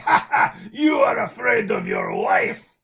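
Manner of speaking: high, loud gloating villain laugh and taunt, slow; hammed-up villain crowing in triumph; Bond villain voice. Python mocking the buyer about his wife, loud gloating villain. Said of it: "Seriously, he talked just like that".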